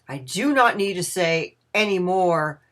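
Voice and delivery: loud, emphatic saintly address; emphatic pronouncement. A narrator's reading. Bede hushes humble Ebenezer and praises his service, emphatic pronouncement. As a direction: hush him, then proclaim his virtues firmly.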